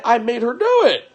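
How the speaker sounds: high mock whine; mocking the wife. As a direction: mimic her excuse in a high voice. Mocking wife's excuse.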